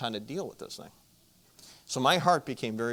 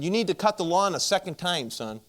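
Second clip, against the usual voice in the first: raised voice over a lawnmower noise; mother shouting to be heard over the mower; mom yelling over mower; 5 semitones higher, 5 dB louder than usual. Retold or imitated. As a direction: call out over the engine noise. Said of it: "she's kinda yelling over the old Jacobson mower".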